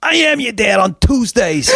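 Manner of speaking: high, loud sing-song retort; hammed-up uncle's sing-song comeback; mock obnoxious uncle. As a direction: belt it like a proud obnoxious uncle. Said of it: "he goes".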